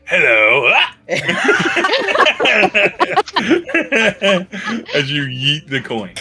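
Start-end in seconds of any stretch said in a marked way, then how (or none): 0.1-0.7 creepy voice in the head, louder; spooky disembodied greeting; the coin greets Yuri in his head; eerie coin voice. Acted. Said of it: "you just hear this voice go". 0.8-4.9 high, long spooky cackle; the coin's voice cackling; eerie high laugh. Acted. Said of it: "this voice go".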